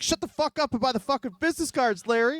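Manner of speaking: high, mock-aggressive sales pitch; lightly hammed-up phone thug; mock tough guy. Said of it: "And he's like".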